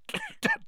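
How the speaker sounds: sharp choking cough; coughing fit. A narrator's reading. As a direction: cough up the ash. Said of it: "he coughed".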